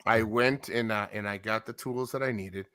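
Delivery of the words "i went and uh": normal talking voice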